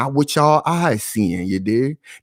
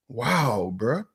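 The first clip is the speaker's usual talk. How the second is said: ordinary talk, nothing marked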